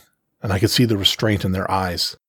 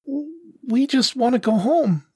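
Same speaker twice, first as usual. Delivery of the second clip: tiny, very high, slow pleading voice; sniffling kitten; slower than usual. A narrator's reading. Oldest serval cub pleads with the panther, sniffling. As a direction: plead like a tearful little child. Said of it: "the oldest serval sniffled".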